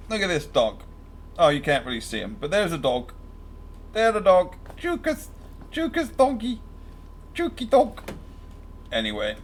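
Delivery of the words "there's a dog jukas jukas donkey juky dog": high, soft cooing pet voice. Real, not acted. Owner coos softly at his husky in a high pet voice.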